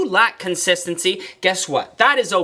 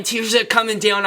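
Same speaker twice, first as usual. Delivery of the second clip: ordinary talk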